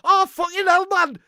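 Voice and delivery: high, loud mock-Geordie neighbour cussing; badly faked Geordie, yelling in dismay; attempted Geordie accent. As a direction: imitate a Geordie neighbour shouting over the garden. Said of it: "I can't do Geordie".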